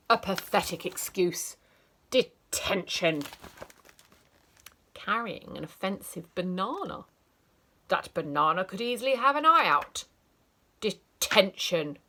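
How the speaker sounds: loud, furious scolding; furious teacher scolding a sneezer; stern teacher shout. Acted. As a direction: bark it at the child.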